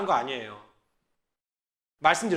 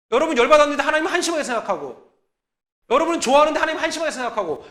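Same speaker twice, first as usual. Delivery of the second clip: louder, faster repeat; 5 semitones higher, 6 dB louder, faster than usual. Acted. Sermon repetition building.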